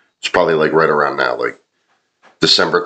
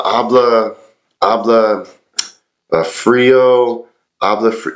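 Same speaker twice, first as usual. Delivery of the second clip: awful Spanish; 6 semitones higher than usual. Retold or imitated. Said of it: "I was trying to say in my awful Spanish".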